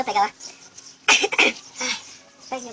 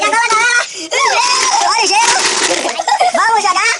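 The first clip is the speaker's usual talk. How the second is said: loud excited call to viewers; shouting; 5 semitones higher, 5 dB louder than usual. Real, not acted. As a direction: hype it up, loud. Calling out to camera.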